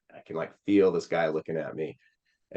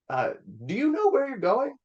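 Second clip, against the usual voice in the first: much higher, doubtful imitated driver voice; skeptical driver. Friend's dad doubting the boy's directions, high mock-skeptical. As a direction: mimic the dad questioning him.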